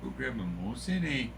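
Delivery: casual Canadian with eh; light fake Canadian invite; mock Canadian accent for a beer. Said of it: "speaking a Canadian accent".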